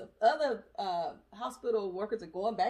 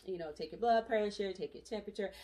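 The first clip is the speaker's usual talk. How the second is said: ordinary delivery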